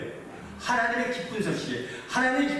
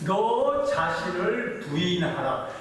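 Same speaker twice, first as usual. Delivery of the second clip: very slow, every syllable stretched; drawn-out emphasis; much slower than usual. Acted. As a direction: press each word into the congregation. (아주 천천히 한 자 한 자 늘여서)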